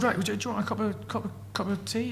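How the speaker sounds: lightly voiced eager host offering tea; fussy host. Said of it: "he said".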